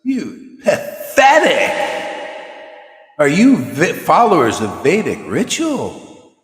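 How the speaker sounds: hammed-up guru contempt; imitating the guru's scorn. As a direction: drag out "pathetic" like a stern guru mocking them. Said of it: "this is how he's saying".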